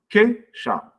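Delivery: "Queixar" as QUEI, pause, XAR, pitched high; split into syllables. Shown as a demonstration. Teacher repeating a non-hiatus for pupils, syllables split. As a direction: break "Queixar" only after QUEI, EI held together. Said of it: "isso aqui é tudo junto na mesma sílaba".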